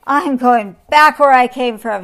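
roared. A narrator's reading. Lion abandons the bed, roared. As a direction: bellow it, storming off. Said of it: "he roared".

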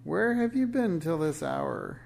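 high, loud scolding question; witch's screech. A narrator's reading. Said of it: "she screeched".

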